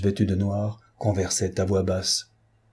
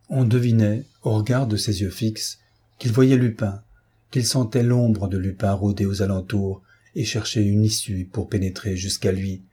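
ordinary delivery, nothing marked

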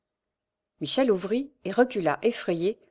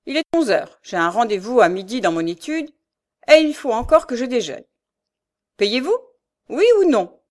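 loud, higher-pitched pressing tone, mounting; impatient demand; 5 semitones higher, 8 dB louder than usual. A narrator's reading. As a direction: press for an answer, ending high and loud. Notary impatiently demands immediate payment. (exigence impatiente, de plus en plus forte)

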